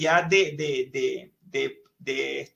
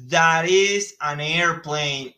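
slow model sentence; 3 dB louder, much slower than usual. Shown as a demonstration. Teacher modelling English for Spanish-speaking students, slow and careful. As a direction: spell out the English sentence slowly, word by word. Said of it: "Fíjense cómo aquí decimos".

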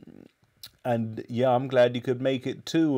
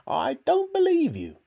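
grandma. A narrator's reading. Grandma answering George, screechy disbelief. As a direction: dismiss him in a piercing granny voice. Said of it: "she answered".

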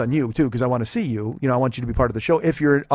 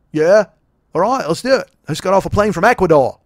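put-on Australian accent; 4 semitones higher, 6 dB louder than usual.

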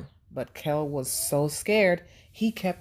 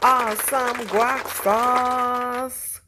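slow, loud, stretched-out cheer; cheering catchphrase; 7 semitones higher, 5 dB louder, much slower than usual. Acted. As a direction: celebrate the right answer with a big drawn-out cheer. Host cheers right answer.

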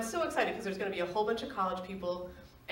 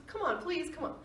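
higher, wheedling please; coaxing plea; 8 semitones higher than usual. Retold or imitated. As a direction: beg sweetly one more time. Boyfriend begging again.

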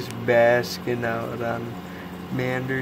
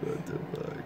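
whispered pet voice; 10 dB quieter than usual. Pretend. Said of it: "He's like".

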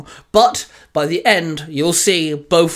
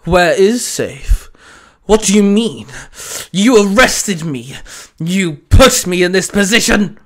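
loud, higher, aggrieved bark; barked accusation; 3 semitones higher, 5 dB louder than usual. Acted. Danzo blames the ranger who arrested him, barked. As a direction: throw the blame back loudly. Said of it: "Danzo barked".

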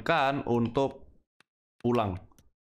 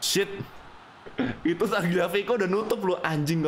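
frustrated outburst; 7 semitones higher than usual. Real, not acted.

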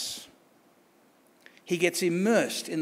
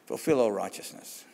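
hushed and much lower, almost whispered; Jesus calmly quieting John; Jesus, softly; 8 semitones lower, 6 dB quieter than usual. Retold or imitated.

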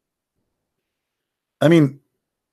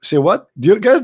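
usual speaking voice

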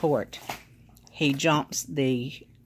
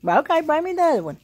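dog commands; 3 semitones higher, 9 dB louder than usual. Real, not acted. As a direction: coax the dog into sitting, pitched up. Praising the dog.

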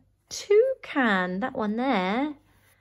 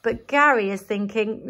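plain reading voice

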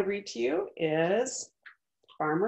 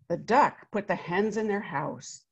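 put-on lively read-aloud voice for children; story narration; 4 dB louder than usual. A narrator's reading. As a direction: tell it like a picture book to a class. Reads picture book aloud.

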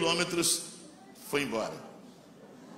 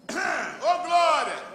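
loud, high cry of Ô, glória; shout of glory; 13 semitones higher, 5 dB louder, much slower than usual. Shown as a demonstration. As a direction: shout it out across the bus stop. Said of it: "eu fiz assim, ó".

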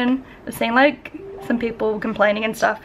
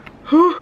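forceful puff of breath; loud sigh; 8 semitones higher, 6 dB louder than usual. Real, not acted. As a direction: blow out a big sigh before a long day. Sighs at workload ahead.

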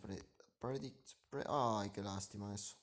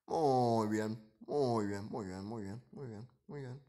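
excited cheer; 9 dB louder, slower than usual. Real, not acted. Cheering own troops' play.

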